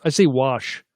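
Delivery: ordinary talk, nothing marked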